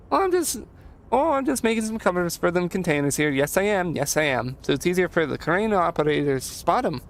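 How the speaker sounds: lilting, folksy helper voice; chatty simple handyman, pitch jumping up at the start; eager Leo. Acted. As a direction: play an eager, busy handyman. Voices Leo in game dialogue.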